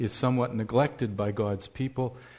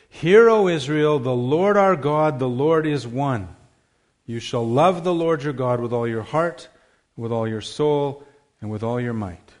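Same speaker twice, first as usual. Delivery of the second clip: scripture reading; 7 dB louder than usual. Acted. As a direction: declare each line to the congregation. Recites the Shema as climax.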